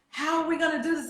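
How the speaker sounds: fretting aloud, acted out; retold panic. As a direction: replay a panicked moment, pitch lifted. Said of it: "I'm, like, panicking".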